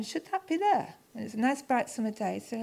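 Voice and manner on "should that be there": much higher, a questioning lilt; puzzled surprise. Retold or imitated. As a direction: sound suddenly suspicious of the spot. Spotting a damp spot with Ken.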